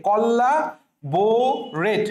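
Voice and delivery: Collaborate stretched very slowly; teacher models collaborate slowly; slow pronunciation. Shown as a demonstration. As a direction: sound out collaborate syllable by syllable. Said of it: "কোলাবোরেটের সঠিক উচ্চারণ".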